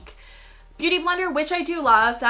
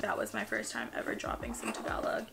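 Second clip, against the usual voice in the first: whisper; 9 dB quieter than usual. Real, not acted. Whispered aside.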